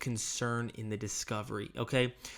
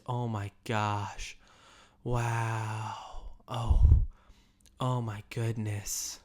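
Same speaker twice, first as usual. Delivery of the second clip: slow, lower "oh my gosh, wow", vowels drawn out; extended syllables; 4 semitones lower, much slower than usual. Shown as a demonstration. Trainer demonstrating concern to sales agents, syllables drawn out. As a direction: stretch every word of "Oh my goodness" with concern. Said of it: "extending some of your sub-, syllables".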